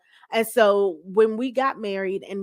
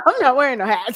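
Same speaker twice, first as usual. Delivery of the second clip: higher, louder through laughter; laughing refusal; 4 semitones higher, 5 dB louder, faster than usual. Real, not acted. First lady joking about expected hats, laughing refusal. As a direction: laugh while putting your foot down.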